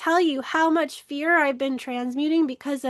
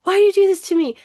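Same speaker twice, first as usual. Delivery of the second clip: mock plaintive cry, pitched high and loud; retold tearful protest, raised voice; tearful complaint; 6 semitones higher, 7 dB louder, much faster than usual. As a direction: imitate an upset mother's loud, high wail of complaint. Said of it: "cries and she's like".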